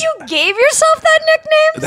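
high, disbelieving question; a squeak of amazed disbelief; incredulous. Real, not acted. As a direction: ask it in total disbelief. Incredulous at the nickname.

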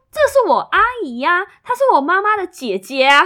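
高声惊喜地认出; 拔高嗓子喊出来; excited recognition. Retold or imitated. Coworker recognizes aunt in photo. (同事看到照片高声认出阿姨)

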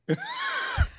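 sudden high whooping laugh; real high laugh bursting out; burst of laughter. Real, not acted. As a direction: let a big real laugh explode out.

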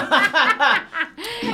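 squealing high laugh. Real, not acted.

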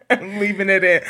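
laughing while teasing. Real, not acted. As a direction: giggle through it.

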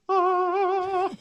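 long, high, loud heavenly "aah"; high sustained "aah". Retold or imitated. Telling Dan how the ad hit him in his truck, high awestruck "aah". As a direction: sing out one ringing high note like a revelation. Revelation through the truck radio.

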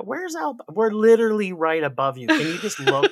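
pointing out the obvious, fed up; exasperated. Retold or imitated. Canadian telling Montanans where Alberta is, exasperated and loud.

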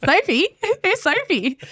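high-pitched imitation of a puzzled colleague; mock bafflement. Imitating a coach who can't place her, baffled. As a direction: squeak out the puzzled questions, half laughing.

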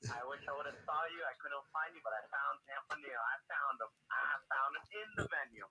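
distant, muffled phone line; echoing tunnel. Real, not acted. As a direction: caller sounding like in a tunnel. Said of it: "Sounds like he's in a tunnel or something".

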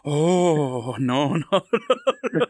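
drawn-out cry of protest, played for laughs; hammed-up retold wail of dismay; mock distress. Acting out her reaction to punch.

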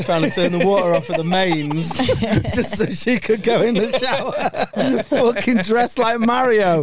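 laughing so hard the words go up high and slow. Real, not acted. Telling her mate about the flatmate while laughing.

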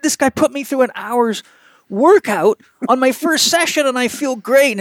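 thrilled patient. Retold or imitated. Pitcher telling his doctor about rehab, excited and high. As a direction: gush about the new therapist. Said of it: "he was high as a kite".

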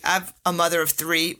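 ordinary talk

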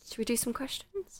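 playful whispered question. Real, not acted. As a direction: whisper it like a secret. Hushed aside before questions.

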